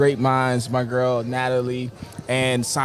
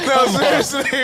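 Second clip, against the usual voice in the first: laughing; 9 semitones higher than usual. Real, not acted. Laughing protest.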